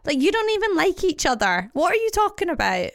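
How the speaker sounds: sarcastic high "are you okay, hon"; incredulous mock concern. Friend mocking a bickering couple at a wedding, incredulous. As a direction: talk down to the bickering couple, faux-sweet.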